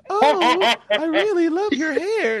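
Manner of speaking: hammed-up gushing girl; gushing high fake voice. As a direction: swoon over the hair in a squeaky voice. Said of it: "they're just like".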